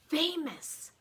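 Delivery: ordinary delivery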